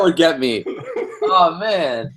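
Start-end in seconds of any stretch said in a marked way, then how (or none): none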